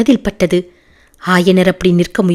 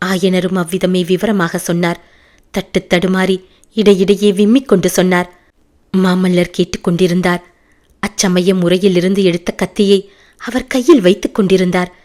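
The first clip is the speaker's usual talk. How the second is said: ordinary delivery, nothing marked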